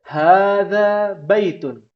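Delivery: teacher models "Hadza baitun." loudly for the class to echo; slow model reading. Shown as a demonstration. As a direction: stretch out "Hadza baitun." for the group to repeat. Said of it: "Ana akan bacakan".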